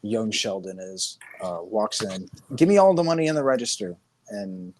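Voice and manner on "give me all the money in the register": loud, fast mock holdup; hammed-up stickup line; mock robber demand. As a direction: bark it like a robber. Robber demand in retold scene.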